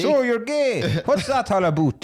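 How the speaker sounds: put-on high, loose pan-American accent; muddled Canadian accent. Joker mimicking a Canadian youth pastor, muddled North American accent. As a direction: attempt a Canadian accent, pitched high, wandering between accents. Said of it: "how many accents I went through".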